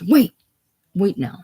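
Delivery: loud sharp wait; loud threatening halt; shout. A narrator's reading. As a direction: bark the order. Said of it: "Hogan shouts".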